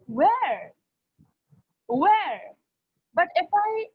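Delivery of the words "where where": Where with a big rise-and-fall swoop; astonished, drawn-out "Where"; surprised. Shown as a demonstration. Teacher showing intonation for surprise, surprised Where. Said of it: "Like for surprise, what I will say".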